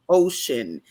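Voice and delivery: ordinary talk, nothing marked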